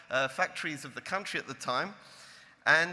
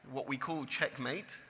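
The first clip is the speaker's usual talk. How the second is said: normal talking voice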